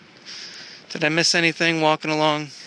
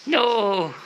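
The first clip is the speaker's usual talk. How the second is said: high cooed no; 9 semitones higher than usual. Real, not acted. Telling cat off.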